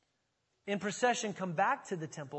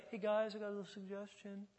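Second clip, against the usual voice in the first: softer, timid mock suggestion; mild casual suggestion; 3 semitones higher, 6 dB quieter than usual. Preacher mocking a too-meek Jesus, soft casual suggestion. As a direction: toss it out meekly, as a mock understatement. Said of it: "It doesn't mean he's like".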